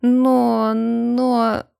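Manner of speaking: drawn-out, hesitant protest; stretched, uncertain objection; faltering excuse. A narrator's reading. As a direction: start to justify yourself and stall. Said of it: "начал было оправдываться Мади".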